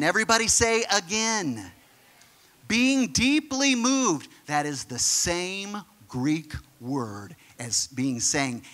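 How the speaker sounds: ordinary talk, nothing marked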